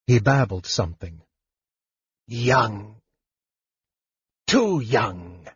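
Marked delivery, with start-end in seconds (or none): 2.3-5.5 lightly voiced draconian, a touch of exultation; exultant repeat. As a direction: savour the words, a hint of triumph. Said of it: "Gakan repeated in exultation".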